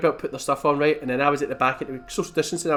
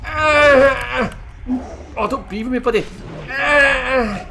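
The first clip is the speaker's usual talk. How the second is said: put-on higher, louder creaky old geezer; old man voice; 5 semitones higher, 5 dB louder, slower than usual. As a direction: play the grumpy old man shooing the kid away. Voicing the old NPC in game.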